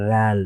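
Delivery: লাল said light and thin, teaching the Arabic sound; light Arabic letter. Shown as a demonstration. Teacher showing students a light লাল. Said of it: "পাতলা".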